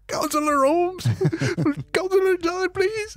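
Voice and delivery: put-on high, pleading whimper of frightened kids; crying kids. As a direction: beg like scared children near tears. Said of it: "they start crying in there".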